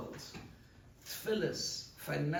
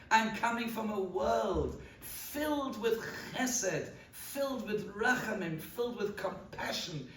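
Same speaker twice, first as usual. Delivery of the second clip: fervent plea; 6 semitones higher than usual. Acted. Tzaddik argues Klal Yisrael's case before HaKadosh Baruch Hu, impassioned and rising. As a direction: build it into a soaring, defiant plea.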